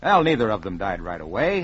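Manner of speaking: plain reading voice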